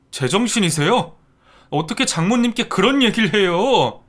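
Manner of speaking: loud, high indignant snapping; angry outburst. Acted. Son-in-law snapping at his stepmother angrily. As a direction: lash out at your mother. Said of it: "버럭 화를 내는 겁니다".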